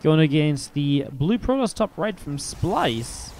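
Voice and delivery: slow, drawn-out player introduction; slow announcer read; announcer intro. Acted.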